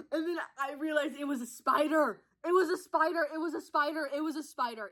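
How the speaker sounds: hammed-up spider panic; dramatic horror. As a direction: cry it out like a horrified discovery. Reliving horror of spider, repeating.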